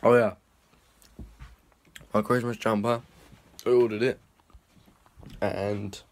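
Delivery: ordinary talk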